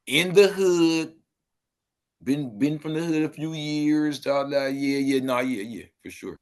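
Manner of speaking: slow, drawn-out gangbanger imitation; different timing. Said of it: "they on some whole different type of timing".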